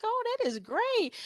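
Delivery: high, gushing oh that is great; mock delight. Photographer gushing to a bride, high mock delight. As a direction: play up the enthusiasm.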